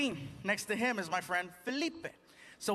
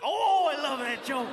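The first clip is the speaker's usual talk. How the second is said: louder, higher, drawn-out gush; gushing fan; 4 semitones higher, 5 dB louder, slower than usual. Retold or imitated. Cop praising the chocolate cake joke, loud gush. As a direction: burst out with slow, swooping delight.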